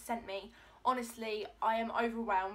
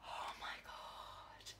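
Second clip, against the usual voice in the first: slow breathless whisper; stunned whisper over a gift; awed whisper; 12 dB quieter, much slower than usual. Real, not acted. Overcome by a gift.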